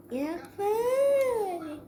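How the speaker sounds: strained drawn-out. Acted.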